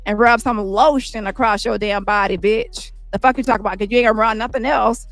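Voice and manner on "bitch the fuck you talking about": ordinary talk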